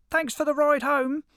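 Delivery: very high, loud gloating squeak; cheeky hedgehog. Acted. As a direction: crow it out like a tiny trickster.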